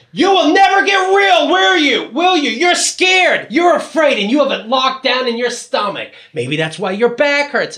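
high, strained mock harangue, easing at the end; yelling leader. He mimics the leader yelling at him, high haranguing voice. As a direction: badger them at a high pitch, then settle down. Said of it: "He's really just yelling at me".

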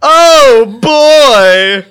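high, loud, very slow moaning; orgasm voice.

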